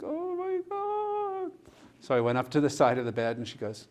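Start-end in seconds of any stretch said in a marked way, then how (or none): 0.0-1.5 very high, slow gasped oh my God; mock dread. Watching the angry patient wave him over, high slow dread. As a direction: stretch it out in mock horror.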